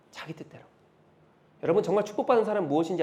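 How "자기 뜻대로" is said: breathy whispered echo of 자기 뜻대로. Acted. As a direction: drop to a bare whisper on 자기 뜻대로. Whispered echo of previous line.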